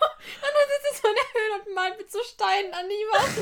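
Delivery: real giggles breaking up the sentence; laughing. Real, not acted. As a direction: describe it while trying not to crack up. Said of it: "Wahrscheinlich lacht gerade niemand außer uns".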